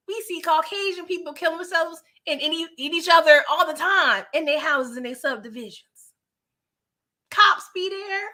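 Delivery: high, heated disbelief; so worked up at Meech the voice stays high. Real, not acted. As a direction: argue it like it's obvious. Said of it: "I'm getting all worked up".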